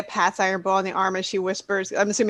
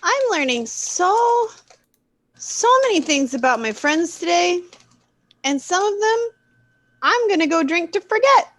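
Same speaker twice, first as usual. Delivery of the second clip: exaggerated overwhelm; 9 semitones higher, 5 dB louder than usual. Woman learns too much about friends, announces drinking, high and overwrought. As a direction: stretch it out, pitched up, with comic alarm.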